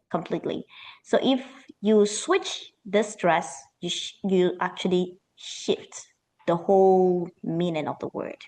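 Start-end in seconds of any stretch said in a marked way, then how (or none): none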